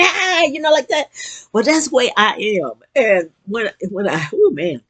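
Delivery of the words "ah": high, loud demo scream of joy; excited squeal, imitating a contestant; imitated joyful scream. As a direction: scream like a game show winner, briefly. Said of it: "jumping up and down and screaming".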